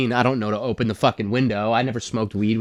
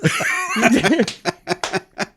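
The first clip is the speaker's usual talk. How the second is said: laughing; 9 semitones higher than usual. Real, not acted. After punchline.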